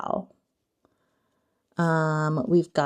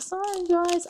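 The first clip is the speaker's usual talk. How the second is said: sheepish apology; 12 semitones higher than usual. Retold or imitated. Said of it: "And I'm like".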